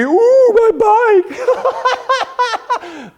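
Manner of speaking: sing-song jeer like schoolkids teasing; mocking taunt. Kids mocking him.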